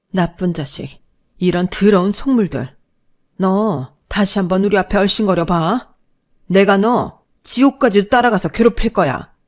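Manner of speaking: loud, rising rage at him; furious threat. Acted. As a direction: build to a shouted curse. Mother curses son-in-law.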